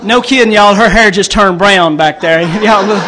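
loud playful call to the audience; loud laughing call to the crowd. Real, not acted. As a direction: call it out to the room over the laughter.

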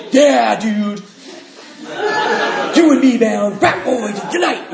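high, loud bro voice, laughing at the end; mock buddy voice. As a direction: cheer on a drinking buddy. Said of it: "And you just be like".

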